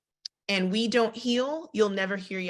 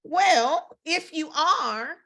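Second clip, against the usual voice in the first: high, doubtful, drawn-out if you are; skeptical Abram; 9 semitones higher, 3 dB louder, much slower than usual. Retold or imitated. Abram doubting God's promise to him, skeptical high voice. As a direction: question the promise with a laugh. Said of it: "He said".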